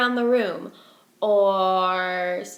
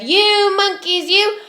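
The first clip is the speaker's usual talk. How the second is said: higher, louder and slow, shaking both hands; angry peddler; 8 semitones higher, 9 dB louder, slower than usual. Acted. Peddler angrily orders the monkeys to return his caps. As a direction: scold them hard, drawing out each word. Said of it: "This made the peddler angry".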